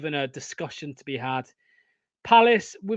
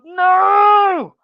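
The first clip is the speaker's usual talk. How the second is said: much higher and louder, a real cry of despair; full-throated fan howl of horror; anguished shout; 13 semitones higher, 14 dB louder than usual. Real, not acted. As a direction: scream it at the screen. Penalty given against his team.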